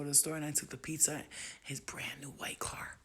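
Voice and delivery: breathy whispered storytelling. Real, not acted. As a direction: whisper it like a secret.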